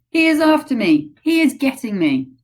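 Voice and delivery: high, loud panicked wail; terrified cries. Acted. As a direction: cry out in terror. Giant screaming in nightmare.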